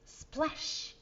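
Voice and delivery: ordinary delivery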